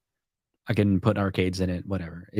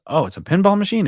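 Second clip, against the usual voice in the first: high, fast incredulous echo; surprised question; 10 semitones higher, 4 dB louder, faster than usual. Retold or imitated. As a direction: mimic the guy's surprise, pitched up. Delivery guy's surprised retold question.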